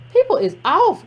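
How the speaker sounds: ordinary talk, nothing marked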